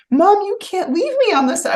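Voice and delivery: high mock wail of a little boy; crying son. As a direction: whine it up high, pleading with mom. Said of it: "he started crying again".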